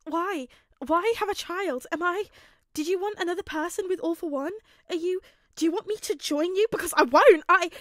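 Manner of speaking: demanding answers in mounting hysteria; hysterical. Acted. Izuku confronting his father, hysterical and shouting. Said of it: "rather hysterical".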